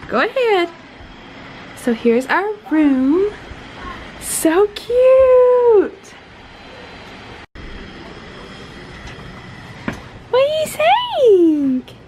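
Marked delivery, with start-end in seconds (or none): 4.2-11.9 excited, high-pitched. Real, not acted. Delighted at hotel room.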